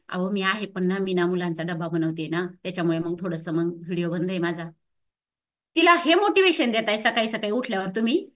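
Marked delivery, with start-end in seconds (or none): 0.1-4.7 low, monotone mock excuse; subdued, droning excuse-maker; sheepish excuse. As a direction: imitate someone lamely explaining themselves, pitch dropped. Acts coach making lame excuse.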